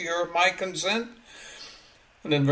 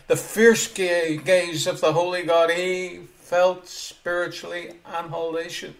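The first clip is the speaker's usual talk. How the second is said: ordinary delivery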